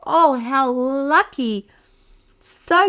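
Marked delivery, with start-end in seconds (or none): none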